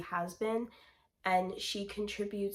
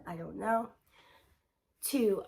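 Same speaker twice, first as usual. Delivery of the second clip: usual speaking voice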